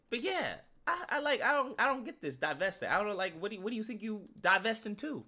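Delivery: ordinary delivery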